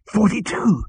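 high excited exclamation; excited cry. A narrator's reading. Zaphod answering Arthur's sum, excited. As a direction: cry it out in triumph. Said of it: "his eyes blazed with excitement".

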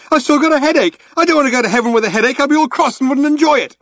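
frantic, high, fast and loud; yelled. A narrator's reading. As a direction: yell it all out in a rush. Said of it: "he yelled".

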